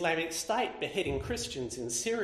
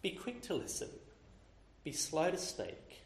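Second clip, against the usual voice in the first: gentle rebuke; 8 dB quieter than usual. Retold or imitated. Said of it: "And James says".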